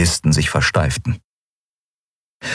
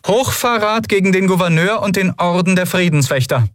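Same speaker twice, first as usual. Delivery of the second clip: much higher, formal officer's voice; female lieutenant voice; 13 semitones higher than usual. Acted. Lieutenant announcing herself to the admiral, in a high formal officer voice.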